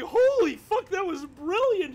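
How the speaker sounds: high, loud retold outburst; amazed. Friend reliving the reaction to a great comeback, high excited exclamation. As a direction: blurt it out in awe.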